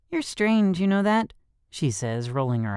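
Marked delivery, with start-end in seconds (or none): 0.1-1.3 put-on girl voice. A narrator's reading. Girl's voice.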